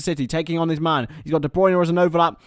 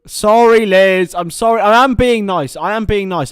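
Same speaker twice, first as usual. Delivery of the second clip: loud protest; 4 semitones higher, 10 dB louder than usual. Real, not acted. Loud apology to sister off mic.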